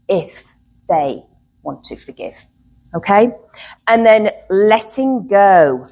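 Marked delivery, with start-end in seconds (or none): 1.6-2.4 whispered aside. Shown as a demonstration. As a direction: whisper the choice, barely voiced. Dropped to a whisper.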